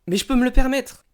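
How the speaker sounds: sudden high, loud burst; excited. Real, not acted. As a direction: proudly blurt it out. Boasting about affording Pokémon cards.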